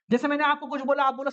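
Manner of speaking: ordinary delivery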